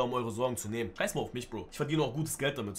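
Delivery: ordinary talk, nothing marked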